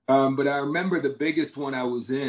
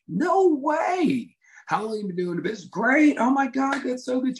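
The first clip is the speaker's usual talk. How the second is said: much higher, excited mock disbelief; hammed-up amazement; 11 semitones higher than usual. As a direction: gush back like you can't believe it. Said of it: "I'm like".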